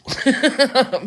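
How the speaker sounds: high, loud burst of laughter; real laugh. Real, not acted. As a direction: burst out laughing mid-thought. Tickled by foreign accent talk.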